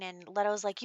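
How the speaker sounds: ordinary delivery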